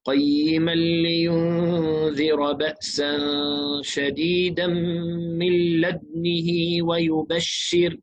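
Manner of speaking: slow chanted recitation, لدنه with still د rounded lips and kasra on ن; Shu'ba's reading. Shown as a demonstration. Teacher reciting Shu'ba's way to students, slow chant. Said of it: "فنقرأ هكذا".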